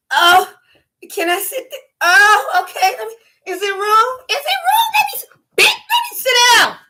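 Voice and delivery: high, loud wailing plea for a seat; mock desperate lady. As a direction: beg for the seat in a shrill mock panic. Imitating woman wanting a seat.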